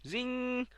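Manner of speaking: high ringing buzzer zing; buzzer sound. Pretend. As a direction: imitate a quiz show buzzer, pitched high. Imitating the game buzzer.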